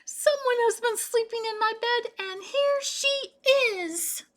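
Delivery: high, loud squeak, slowing at the end; squeaky Baby Bear. A narrator's reading. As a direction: squeak it out like a startled cub.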